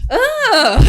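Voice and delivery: high, loud burst of laughing; loud amused cackle; real laughter. Real, not acted. Laughing at her own story.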